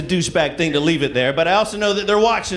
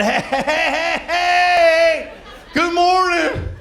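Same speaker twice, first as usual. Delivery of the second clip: high, loud sing-song greeting; overly friendly shout up to the porch; cheery yell; 13 semitones higher, 3 dB louder, much slower than usual. Retold or imitated. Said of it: "I yelled up at her".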